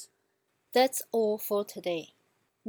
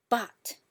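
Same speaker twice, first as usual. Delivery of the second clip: "but" as a falling fourth tone; 2 semitones higher than usual. Shown as a demonstration. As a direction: say "but" with a firm fall. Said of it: "keep repeating them".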